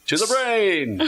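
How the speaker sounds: high, loud heroic call; rally everyone onward; rallying cry. Real, not acted.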